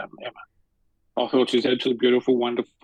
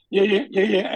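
high, louder fan's eager yeah yeah yeah; excited yeahs; 7 semitones higher, 5 dB louder than usual. Retold or imitated. Starstruck fan meets the actress at the stage door, excited. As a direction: gush as you finally meet her. Fan meeting his idol.

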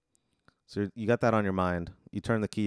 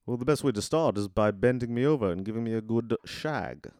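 proper English butler, straight-faced; British butler. Acted. Butler making a cheeky joke to his master, British and deadpan. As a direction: deliver it in a polite British accent. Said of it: "he's an English robot butler".

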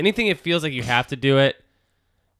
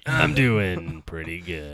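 lower, slow lazy drawl; imitating a slow lazy voice; 7 semitones lower, slower than usual. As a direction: copy the guest's sluggish tone. Mocking July's slow voice.

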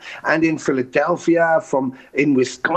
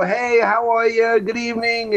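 friendly neighbor greeting; 6 semitones higher than usual. Retold or imitated. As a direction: imitate a cheery porch-sitter calling out. Friendly porch couple greeting passerby.

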